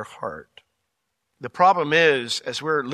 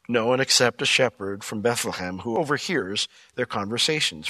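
ordinary talk, nothing marked

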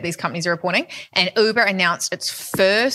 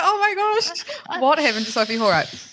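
mock British accent; 9 semitones higher than usual. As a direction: tease her about coming home British. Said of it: "You're gonna be like".

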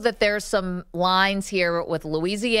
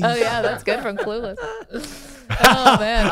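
laughing; 4 semitones higher, 3 dB louder than usual. Real, not acted. Laughing at the impression.

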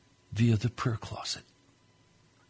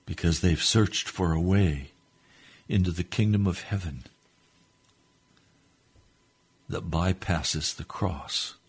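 fervent prayer; 2 semitones higher, 3 dB louder than usual. Real, not acted. Preacher praying to the Lord for his listeners, fervent prayer. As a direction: pray earnestly, hushed then rising to a fervent cry.

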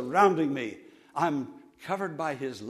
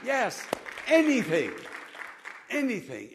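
ordinary delivery

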